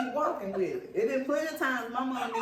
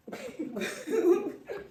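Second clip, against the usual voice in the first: bright squealing laugh; high laugh; 11 semitones higher, 5 dB louder than usual. Real, not acted. Laughing at own echo.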